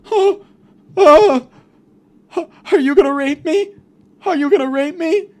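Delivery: high, loud tearful whimper; hammed-up high crying plea; mock terrified captive. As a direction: wail it like a frightened victim. Imagined frightened captive.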